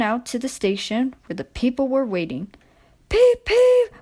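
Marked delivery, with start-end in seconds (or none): 3.1-3.9 very high engine whistle toot. A narrator's reading. Edward whistling to hurry passengers at station. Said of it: "whistled Edward".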